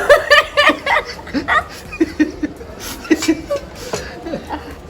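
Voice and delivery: helpless wheezing laugh; airy laugh gasping from the heat; high breathless laugh. Real, not acted. Spicy challenge, ice cube.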